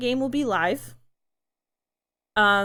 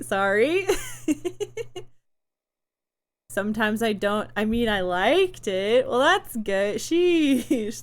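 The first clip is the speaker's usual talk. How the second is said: ordinary talk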